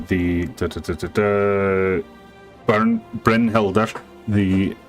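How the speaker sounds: usual speaking voice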